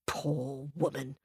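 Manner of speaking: soft, slow pity; hushed royal sympathy; pitying king. Acted. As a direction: say it low in volume, drawn out. King pities wife, shakes head.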